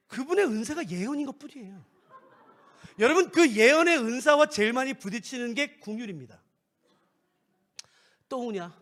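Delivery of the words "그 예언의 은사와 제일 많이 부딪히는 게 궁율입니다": ordinary talk, nothing marked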